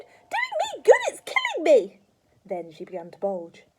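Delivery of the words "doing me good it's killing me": very high, full-volume shriek; screamed. A narrator's reading. Grandma protesting the medicine, screaming. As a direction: scream it in outraged disbelief. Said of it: "she screamed".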